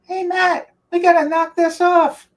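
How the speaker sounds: high, mock coach voice calling Matt in; imitating Joe Paterno. Player imitating coach scolding him in his office, high mock voice. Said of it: "regularly call me in his office and say".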